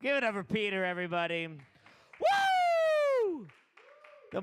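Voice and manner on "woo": very high, loud cheering whoop; excited whoop. Real, not acted. Host hyping the crowd after a set, excited whoop. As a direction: whoop to pump up the room.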